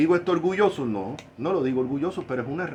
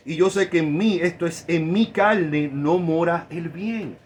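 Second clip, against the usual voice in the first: scripture reading; 3 dB louder, slower than usual. Acted. Reads scripture, slowing down.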